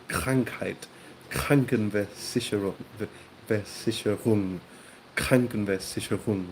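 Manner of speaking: KRANKHEIT and KRANKENVERSICHERUNG with throaty R and hard K; careful German demo, guttural R in KRANKENVERSICHERUNG; back-of-throat German. Shown as a demonstration. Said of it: "using the back of the throat for this pronunciation".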